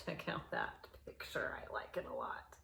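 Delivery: whispered aside. Real, not acted. Reader whispering to the children about the picture. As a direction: lean in and whisper it. Aside to viewers while reading.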